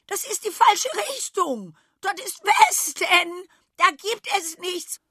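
high, loud, frantic goat. A narrator's reading. Goat calls the runaways back, frantic. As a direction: shout them back in panic. Goat shrieks after them.

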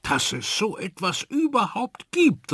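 hoch angesetzter, staunender Ausruf; staunend rufen. A narrator's reading. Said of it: "rief Susanne". (Susanne ruft staunend über den Spinat)

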